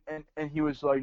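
ordinary talk, nothing marked

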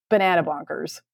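ordinary talk, nothing marked

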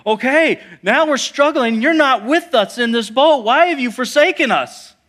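high, loud, exasperated outcry; desperate protest. Acted. Struggling disciples reproaching absent Jesus, high desperate protest. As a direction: cry out in acted accusation, pitch way up.

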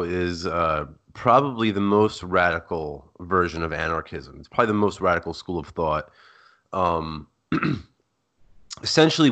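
normal talking voice

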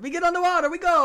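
high, loud hammy Italian boatman; Italian accent. Acted. Boatman answering the players, hammy Italian accent. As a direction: push the pitch up into a cartoon Italian.

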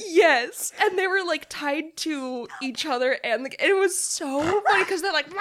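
high, loud and animated; bursting with excitement; excited. Real, not acted. Excited recall of funny scene.

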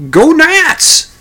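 high, loud furious shout of "Go Nats!"; angry yell. Retold or imitated. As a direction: bellow "Go Nats!" back at the rival fan. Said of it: "the other fella yells at him".

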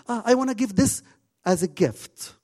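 put-on high, loud, eager little boy voice. [name] telling Nadia about the gift, high eager boy voice. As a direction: play the excited ten-year-old. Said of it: "He said".

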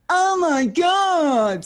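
very high, very slow, loud "Oh my God"; drunken squeal. Retold or imitated. Drunk Izzy squealing back at the fan, high and slow. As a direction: stretch it out in a drunk shriek. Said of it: "And I went".

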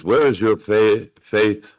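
title called out loud and proud. Shown as a demonstration. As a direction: announce the title with force. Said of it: "Title of this message is the".

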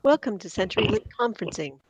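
quiet recorded-system voice; automated phone voice. Shown as a demonstration. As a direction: sound like a conference line recording. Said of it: "I do a good".

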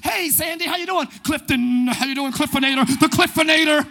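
loud, hyped-up office greeting; over-the-top, extra. Acted. Insecure worker greeting coworkers to be liked, over-the-top and loud. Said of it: "so you all extra".

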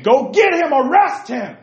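high, loud order to the guards; urgent, high-pitched shout; shouted royal command. Acted. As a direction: bellow it high like a king ordering an arrest. Acted king ordering guards to arrest.

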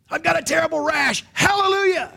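high, loud hammed-up joy; overacted delight at a rash; mock cheerful praise. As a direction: sing out hallelujah over a rash, mockingly. Mocking forced joy.

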